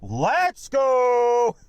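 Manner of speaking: loud, high pumped-up yell; hype intro shout. Pretend. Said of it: "I'd just pump up the, uh, the intro".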